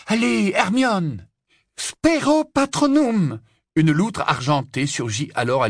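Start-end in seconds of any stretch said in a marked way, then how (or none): 0.1-1.3 high, drawn-out encouragement; urging on. Acted. Harry urges her to retry. 1.8-3.4 very high, loud, drawn-out spell cry; forceful incantation. Acted. Second, successful casting.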